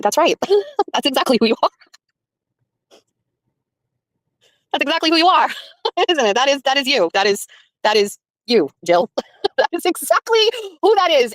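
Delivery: high, giggly replay of the moment, laughter breaking through; nervous laughing, can't believe who's in front of you; laughing in disbelief. Retold or imitated. Stunned, laughing at meeting her.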